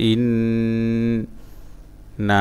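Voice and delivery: separated syllables with long pauses. Shown as a demonstration. Teacher breaking a word down for learners, syllable by syllable. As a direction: sound out one syllable at a time.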